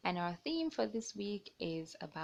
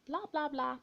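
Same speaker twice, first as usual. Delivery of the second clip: a touch higher, changed chatter voice; chattering trees; 6 semitones higher than usual. Acted. Narrator voicing the trees chattering to the birds, lightly changed. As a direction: babble like gossiping trees.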